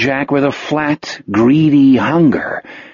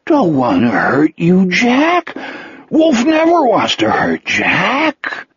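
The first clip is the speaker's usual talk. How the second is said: hurt, slow, high-pitched creature voice; Wolf, distressed; 6 semitones higher, slower than usual. A narrator's reading. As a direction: plead innocence, swooping high on the name. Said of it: "A look of terrible distress".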